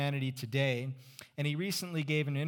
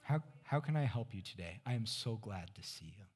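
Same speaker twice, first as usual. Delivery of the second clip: overly sweet teller; 4 semitones lower, 6 dB quieter than usual. Retold or imitated.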